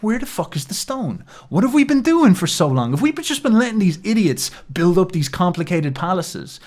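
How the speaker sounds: a rising, exasperated disbelief; mock outrage. Voicing Nietzsche's outrage.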